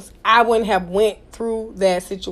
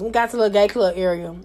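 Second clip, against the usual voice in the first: ordinary delivery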